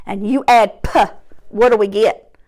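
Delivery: ordinary talk, nothing marked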